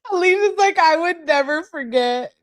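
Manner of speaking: high, squeaky laugh-talk. Real, not acted. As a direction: squeeze the words out while cracking up. Cracking up at chat comment.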